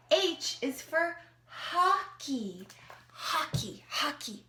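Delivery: usual speaking voice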